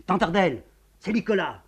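put-on young man calls out loudly through a door; shouting. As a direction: pound on the door and shout your plea. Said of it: "Il crie".

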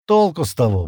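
ordinary delivery

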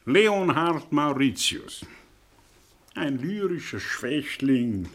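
normal talking voice

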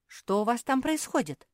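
high, loud wary question; alarmed. A narrator's reading. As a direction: call down, suddenly on alert. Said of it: "насторожилась Мафи".

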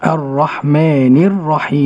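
الرحمن الرحيم recited slowly and carefully; tajweed repetition. Shown as a demonstration. Host echoing the sheikh's recitation slowly. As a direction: repeat after the sheikh slowly. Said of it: "host repeats after sheikh".

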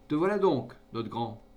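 father greets his son, overjoyed; emotional greeting. A narrator's reading. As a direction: welcome him home with feeling.

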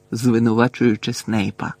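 usual speaking voice

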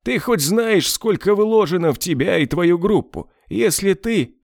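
high-pitched furious rant; an angry boss scolding his hitman; angry boss tirade. Acted. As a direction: berate him from way up high.

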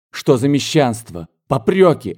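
scornful outburst, rising sharply on Попрёки; contemptuous exclamation. A narrator's reading. Marya scorning her sister's reproaches, contemptuous cry. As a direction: spit it out with disdain, rising high. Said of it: "воскликнула с презрением Марья Николаевна".

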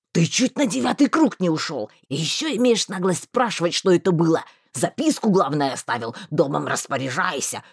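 indignant scolding. A narrator's reading. Marston scolding the rescued friend, indignant rant. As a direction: tear into him.

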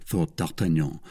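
plain reading voice

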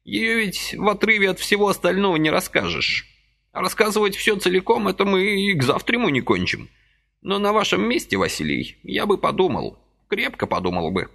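put-on high fussy old man's voice, gently reproaching; a little old man chiding kindly; old man reproaching Vasily for his haste, high and fussy; reproachful old man. A narrator's reading.

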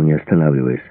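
plain reading voice

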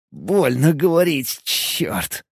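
strained words through pain, dropping at the end; pained wince. A narrator's reading. Injured man struggling to talk, pained. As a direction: curse that falls away into breath. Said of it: "Поморщился я".

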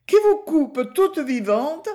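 hammed-up sobbing fright; near tears. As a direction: let the voice quiver as if crying. Wife dramatizes being cut.

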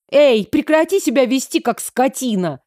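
indignant, high at the start then dropping; exasperated outburst. A narrator's reading. Sister stopping her bullying brother, exasperated rebuke. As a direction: lose patience and scold him. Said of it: "не выдержала я".

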